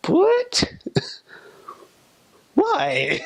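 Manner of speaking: very high squeaked disbelief; baffled reaction. Acted. Reacting to the odd word for shorts, high squeaky bafflement. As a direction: squeak it out, incredulous and laughing. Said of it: "you're kinda like".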